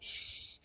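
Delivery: long unvoiced hiss through the teeth; snake hiss. Shown as a demonstration. Teacher shows students how a snake sounds, hissing. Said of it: "एक आवाज़ निकालता है".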